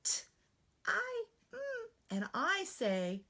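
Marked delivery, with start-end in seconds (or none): 0.0-1.6 put-on much higher child sounding out letters; teacher voicing her student, much higher; little student voice. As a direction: sound out like a young pupil. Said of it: "I'm gonna voice what the student says".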